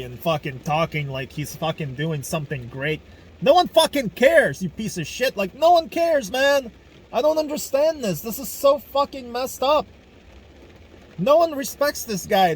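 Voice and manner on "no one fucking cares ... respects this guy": high, loud, outraged shouting; furious yelling. Real, not acted. Ranting about Putin's fans, in high, loud outraged yelling. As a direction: let the outrage boil over.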